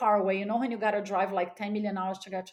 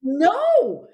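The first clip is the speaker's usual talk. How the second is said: loud sharp no; emphatic no; 6 semitones higher, 9 dB louder than usual. Real, not acted. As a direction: shout it out flatly. Emphatic denial to question.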